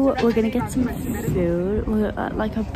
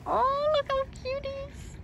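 cooing high voice; 8 dB quieter than usual. Real, not acted. Cooing at cute animal.

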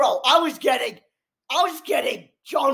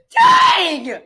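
loud, higher cry of shock; stunned outburst; 5 semitones higher, 7 dB louder than usual. Real, not acted. Viewer reacting to a shocking episode, stunned outburst. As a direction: let the shock burst out.

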